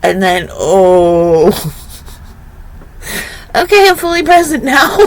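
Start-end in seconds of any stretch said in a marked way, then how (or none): 3.6-5.0 much higher, fast, breaking into laughter; laughing. Real, not acted. Mother telling parenting story, laughing high and fast. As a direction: laugh through the words.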